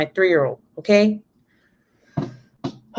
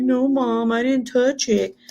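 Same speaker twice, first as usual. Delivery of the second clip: put-on higher-pitched little boy denial; son's voice; 4 semitones higher, slower than usual. Son denying to mom he touched it, higher child voice. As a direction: imitate a small child, slowing at the end. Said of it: "He's like".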